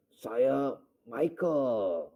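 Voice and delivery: slow mock-grand proclamation; possessed man's voice. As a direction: announce it like a possessing archangel. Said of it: "dalam waktu dia teriak-teriak itu".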